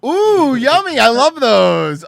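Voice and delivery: high, loud ooh, yummy; delighted. Acted. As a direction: gush with delight over the food. Mock delighted judge.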